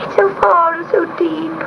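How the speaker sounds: a scared kitten close to tears; sobbing kitten. A narrator's reading. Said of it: "sobbed Gobolino".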